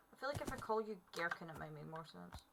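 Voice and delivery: ordinary talk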